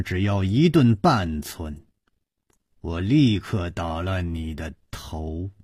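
low, soft and icy woman's voice; chilling quiet menace from a hidden woman; cold threat. A narrator's reading. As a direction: threaten him coldly, barely above a murmur. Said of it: "冷冷地说".

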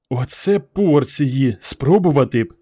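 plain reading voice

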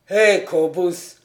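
héler un ami, fort et traînant; exclamation. A narrator's reading. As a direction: call out a hearty, drawn-out greeting. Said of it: "s'écria-t-il". (s'écrier, interpellation forte)

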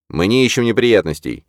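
calm, firm, deliberate statement; firm and clear. A narrator's reading. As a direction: state it plainly and steadily. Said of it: "чётко сообщил я".

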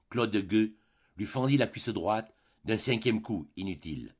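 plain reading voice

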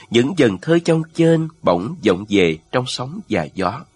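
plain reading voice

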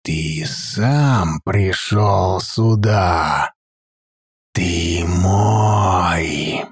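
deep, slow, sinister echoing voice; booming echoing villain monologue. A narrator's reading. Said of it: "прозвучавший мягкий зловещий голос".

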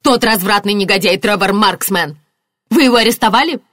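indignant accusation. A narrator's reading. Marianna demanding the suspect's arrest, indignant. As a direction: spit out the accusation, then demand.

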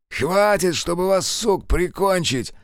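slow, high angry snap; snapping back. Acted. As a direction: snap it out in spite. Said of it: "огрызнулся мужик". (злобно огрызаясь)

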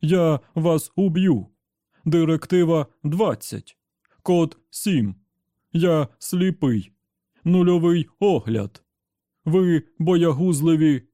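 slow, loud, inhuman robot roar; furious machine voice, dragged out; raging robot. Acted. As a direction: bellow it like a broken machine. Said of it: "закричав робот".

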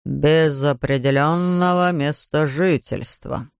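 a constable writing it down importantly; imposing policeman. A narrator's reading. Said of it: "произнёс он внушительно". (внушительно, медленно)

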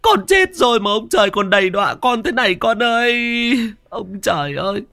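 high, keening lament of a bereaved mother; tearful wailing. A narrator's reading. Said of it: "mà mếu máo". (mếu máo khóc than thảm thiết)

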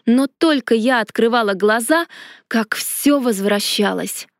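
ordinary delivery, nothing marked

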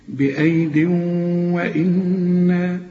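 بأيد وإنا sung slowly, the noon held with nasal ghunna. Shown as a demonstration. Teacher demonstrating idgham with ghunna, slow chant. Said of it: "لازم تغن".

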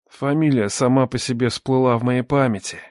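plain reading voice

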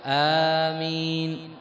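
آمين stretched slowly with long alif; long amen. Shown as a demonstration. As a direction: draw out آمين fully. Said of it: "كيف لفظها؟". (آمين بالمد الطويل)

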